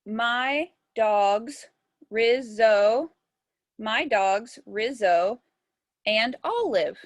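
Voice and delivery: spaced out, rising on each chunk; composing aloud. Shown as a demonstration. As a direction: test the line slowly, beat by beat. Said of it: "How about I put".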